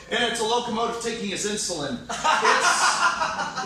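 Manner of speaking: usual speaking voice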